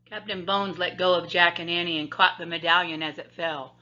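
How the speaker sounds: usual speaking voice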